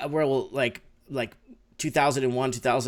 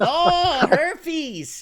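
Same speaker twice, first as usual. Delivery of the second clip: mock shocked; 12 semitones higher, 7 dB louder than usual. Real, not acted. Mock shock at herpes mixup.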